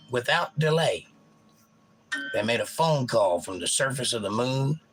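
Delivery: ordinary talk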